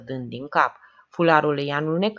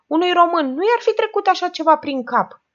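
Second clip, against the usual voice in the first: much higher, put-on sage voice; philosophical; 13 semitones higher, 5 dB louder than usual. A narrator's reading. Ciolac muses about Romanians in a high put-on philosophical voice. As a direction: play the folksy philosopher. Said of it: "observă filozofic Ciolac".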